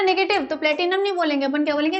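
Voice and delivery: normal talking voice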